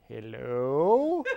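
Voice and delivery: drawn-out, questioning hello, rising at the end; tentative hello. Retold or imitated. Calling to nobody at a closed-off line, tentative. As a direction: call out an unsure hello into emptiness.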